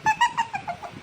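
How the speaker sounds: very high, shrill ghostly cackle; creepy high giggle; eerie laugh. Acted. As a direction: laugh like a possessed girl. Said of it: "என்னடி சிரிக்கிற".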